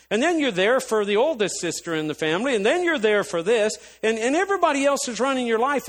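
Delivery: high, loud, relentless scolding; retold tirade. Going off on sister about running to everyone's rescue, heated scolding. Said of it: "this is probably ten minutes of a tirade".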